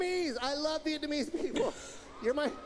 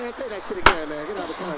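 mock road rage; 9 semitones lower, 9 dB louder, much faster than usual.

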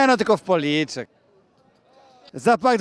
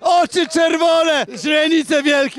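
mock dramatic observation; 7 semitones higher, slower than usual. Host jokes about the guy's eyes.

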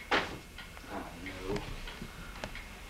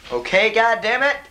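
higher, louder exasperated curse; a raised, exasperated "Okay, God damn it"; 7 semitones higher, 4 dB louder than usual. Acted. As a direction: bark out "God damn it" in frustration. Repeated curse, loud take.

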